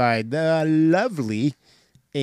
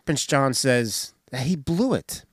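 ordinary delivery

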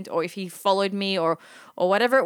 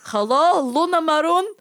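loud, high mock caller at the door; gentleman caller; 8 semitones higher, 5 dB louder than usual. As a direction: call out teasingly as a pretend suitor. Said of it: "a gentleman caller coming to my door".

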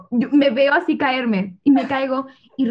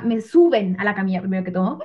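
ordinary talk, nothing marked

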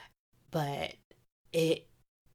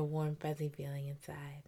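whisper; 4 semitones lower, 12 dB quieter than usual. Real, not acted. Whispered aside.

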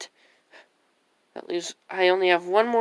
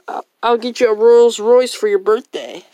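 normal talking voice

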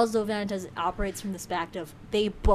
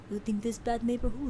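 higher, softer mock Russian accent; attempted Russian accent; 4 semitones higher, 7 dB quieter than usual. Stranger in scary part of town, Russian accent. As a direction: put on an amateur Russian accent. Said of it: "more realistic sounding Russian accent".

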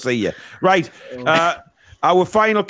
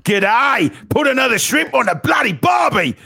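hammed-up Australian accent, higher and shouted; mock Australian accent; 6 semitones higher than usual. Host teasing the Australian caller in a mock Australian accent. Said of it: "I detect an Australian here".

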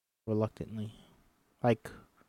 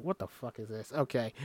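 usual speaking voice

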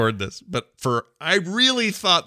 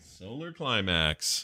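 slow mock repeat; 8 dB quieter, much slower than usual. Mocking the movie line.

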